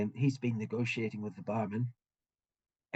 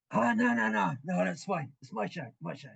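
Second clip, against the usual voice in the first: cheerful refusal; 8 semitones higher, 3 dB louder than usual. Acted. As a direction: wave off the money with big friendly glee. Young guy refuses their money.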